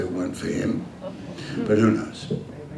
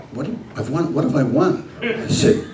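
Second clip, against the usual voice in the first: ordinary talk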